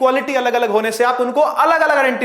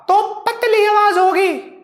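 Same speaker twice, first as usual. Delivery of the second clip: much higher, slower, squeezed-out thin voice; teacher forcing a high thin voice to show high pitch; forced thin voice; 11 semitones higher, slower than usual. Shown as a demonstration. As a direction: strain up into a girlish thin pitch. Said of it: "निकालनी पड़ रही है मुझे जबरदस्ती".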